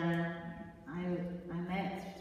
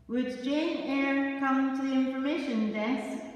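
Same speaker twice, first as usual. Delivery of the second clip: higher, louder announcer call with a lifted end; louder tannoy announcement rising on Jane; airport speaker voice; 7 semitones higher, 9 dB louder, slower than usual. Retold or imitated. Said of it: "the speaker voice at Heathrow Airport said".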